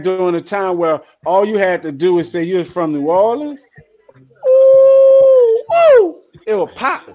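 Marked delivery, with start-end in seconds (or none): 4.5-6.2 high hooting ooh and woo; loud falsetto whoop; excited whoop. Retold or imitated. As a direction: whoop it up like the crowd. Hyping old New Orleans days.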